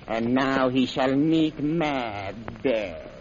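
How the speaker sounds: ordinary talk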